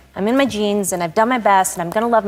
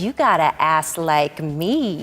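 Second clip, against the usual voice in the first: slow, stretched-out imitated compliment; 3 semitones lower, much slower than usual. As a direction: draw every word out with swagger. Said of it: "And she goes".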